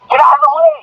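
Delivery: high-pitched cop shouting a warning; retold cop's yell. Cop yelling at running man.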